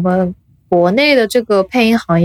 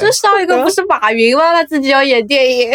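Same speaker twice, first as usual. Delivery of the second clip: higher, laughing as she says it; laughing joke; 7 semitones higher than usual. Real, not acted. Host laughing about Jack Ma wanting to act. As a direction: crack up at the comparison.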